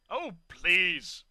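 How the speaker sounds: scornful dismissive huff; huffy. A narrator's reading. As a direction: brush them off with scorn.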